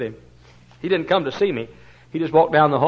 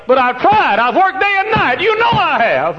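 loud, high, fervent preaching; preaching at full force; 12 semitones higher, 10 dB louder than usual. Acted. Pastor pleading with his congregation, loud and fervent. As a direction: preach it out with a breaking plea.

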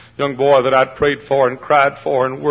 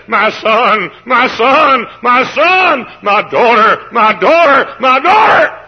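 each call higher and louder, ending in a shout; broken-hearted plea; 8 semitones higher, 6 dB louder, slower than usual. Acted. Loving parent calling to a straying child, building to a shout. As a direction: cry out to a wayward child with a breaking heart. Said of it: "with tear in the eye and a broken heart says".

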